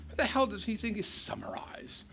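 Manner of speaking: whispered aside in disbelief; exasperated whisper. Real, not acted. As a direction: hiss it under your breath, baffled.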